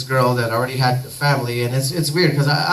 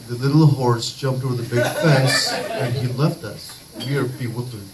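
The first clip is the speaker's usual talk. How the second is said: fake British accent; 4 semitones lower than usual. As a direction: switch into a mock British accent, lower and measured. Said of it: "I'm doing it in your British accent".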